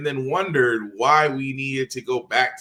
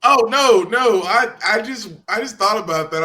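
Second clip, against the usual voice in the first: laughing; 6 semitones higher, 6 dB louder than usual. Real, not acted. Cracking up at the realization.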